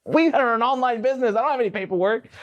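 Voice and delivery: high-pitched, incredulous explanation; exasperated. Retold or imitated. Boss explaining to new hire there is no paperwork, exasperated.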